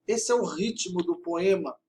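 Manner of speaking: ordinary delivery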